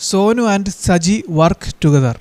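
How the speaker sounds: very slow, word by word, for copying down; slow dictation. Shown as a demonstration. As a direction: dictate it slowly so students can write. Teacher dictates example sentence. (പതുക്കെ എഴുതാൻ പറഞ്ഞുകൊടുക്കുന്നു)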